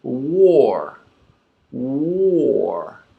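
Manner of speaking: War said alone, glided. Shown as a demonstration. Teacher adding the W, smooth. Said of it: "we put the W in front".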